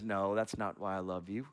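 soft, low, fond answer; gentle father. Acted. Father squirrel gently answering his son's question. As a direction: let the dad answer softly and kindly. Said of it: "the dad always says".

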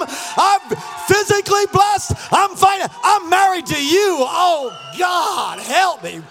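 loud, high, drawn-out shouted declarations; full-force preaching. Real, not acted. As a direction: shout the blessings out to rouse the congregation.

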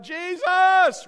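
high, full-voiced mock cry for help. People crying out in a car crash, desperate shout. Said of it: "and they cried out".

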